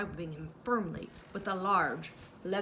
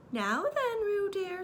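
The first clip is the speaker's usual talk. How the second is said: much higher, sing-song mother voice; mock motherly Kanga; 12 semitones higher, 4 dB louder, much slower than usual. A narrator's reading. Kanga pretending Piglet is Roo, high and sing-song. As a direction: call up bright as a mother. Kanga playing along with joke.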